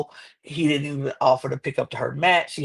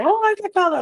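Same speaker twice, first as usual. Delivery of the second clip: much higher, louder picky complaint; fussy protective mom; 5 dB louder, faster than usual. Retold or imitated. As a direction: fuss over the baby like a strict mother. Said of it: "she was like".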